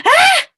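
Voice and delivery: very high, loud gasping cry; acted yelp of shock; shocked shriek. Mouse seeing his reflection, shocked shriek.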